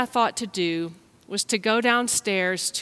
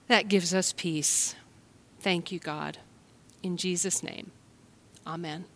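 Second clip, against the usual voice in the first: prayer; 2 semitones lower than usual. Acted. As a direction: pray gently over the children. Leads a prayer.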